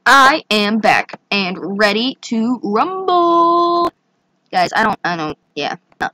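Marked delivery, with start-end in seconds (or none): 0.1-3.9 ready to rumble. Pretend. Announcing return, drawn out.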